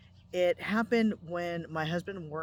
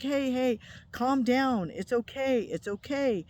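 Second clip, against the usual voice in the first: higher, louder, soothing it's okay; soothing call; 7 semitones higher, 5 dB louder, slower than usual. Retold or imitated. Wife soothing the upset friend in her dream, higher and louder. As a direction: talk her down from her panic.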